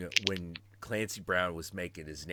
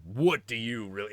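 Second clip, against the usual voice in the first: Clancy Brown as Lex Luthor. Acted. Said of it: "I know that voice".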